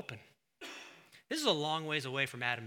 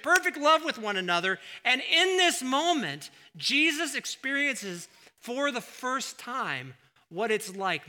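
full force preaching; 8 semitones higher, 11 dB louder than usual. Acted. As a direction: drive it home to the congregation.

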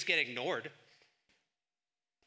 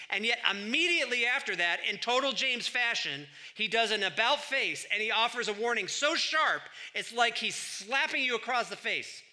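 ordinary talk, nothing marked

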